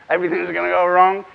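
lightly voiced miserable donkey; a touch of doom-laden pessimism; Eeyore. Trainer voices pessimistic thoughts for nursery classes, mildly miserable.

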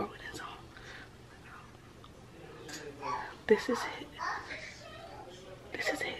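whispering. Real, not acted. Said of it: "I'm whispering because".

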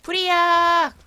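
loud high cry of fire; fire alarm shout. Acted. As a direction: yell it like raising the alarm. Fire breaks out in game.

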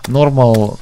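ordinary delivery

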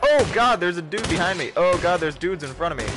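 high, loud frantic panic; panicked. Real, not acted. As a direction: cry out as enemies close in. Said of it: "All the panic".

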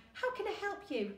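high, sing-song helpful greeting; customer-service greeting. Acted. Attendant to hotel guests, high put-on helpful greeting. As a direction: put on your helpful counter manner.

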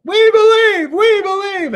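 high roaring crowd reply; crowd cheer. Retold or imitated. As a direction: roar it back like ten thousand people. Crowd answering louder.

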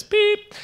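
very high electronic beep; imitated scanner beep. Ticket gate rejecting his ticket, high mock beep. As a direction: squeak it like a scanner.

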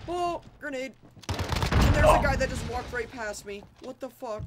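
high, startled callout; startled alarm. Real, not acted. Player warning a teammate of a grenade, high and startled. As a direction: blurt it out in disbelief.

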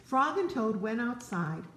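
ordinary delivery

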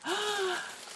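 real high-pitched gasp of surprise; gasp, moved by the gift; amazed gasp. Real, not acted.

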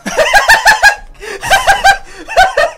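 very high, loud cackling; shrieking laugh. Real, not acted. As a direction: burst into hysterical laughter. Outburst during game. (kreischendes Gelächter)

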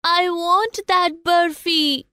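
put-on high, slow child's plea; pleading child. A narrator's reading. Child begs his parents for a sweet, high slow pleading. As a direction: plead like a little boy, drawing out the words. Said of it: "he pleaded to his parents".

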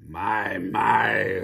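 drawn-out, loud fox voice; rumbling fox. A narrator's reading. Fox eyes the frantic little birds, rumbling. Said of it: "rumbled the fox".

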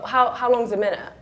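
ordinary talk

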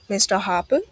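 usual speaking voice

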